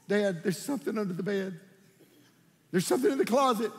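lightly acted worried kid; a touch of childish alarm; frightened child. Frightened child pleading with Dad.

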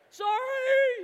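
loud, high, drawn-out holler; yelled apology. Retold or imitated. Skier to lady already gone behind him, long high yell. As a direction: yell sorry after someone far behind you.

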